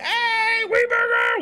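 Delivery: excited greeting. Real, not acted. Greeting a new raider.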